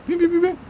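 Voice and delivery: high imitated phone beeps; quick high-pitched beeping; phone ringing. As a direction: mimic a ringing cell phone. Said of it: "some people receive a phone call".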